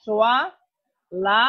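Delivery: normal talking voice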